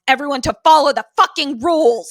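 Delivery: loud, high, fast burst of annoyance; mock exasperation. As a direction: rant like a fed-up stage manager. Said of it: "so annoyed at everyone around him".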